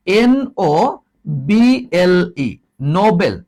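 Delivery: N-O-B-L-E spelled letter by letter, then "নোবেল" said as no-bel with a b sound. Shown as a demonstration. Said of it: "উচ্চারণটা কি?". (ধীরে বানান করে "নোবেল" বলো)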